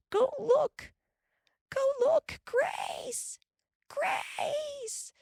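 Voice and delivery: high panicked calls; frantic crying. A narrator's reading. Carrie panics and calls for Grace, frantic. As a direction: break down calling.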